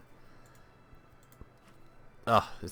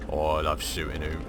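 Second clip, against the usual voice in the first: sneaky villain voice; 4 dB louder than usual. Acted. Gamer plotting an ambush on enemy tanks, sly villain voice.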